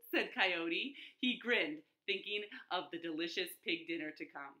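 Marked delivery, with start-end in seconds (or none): none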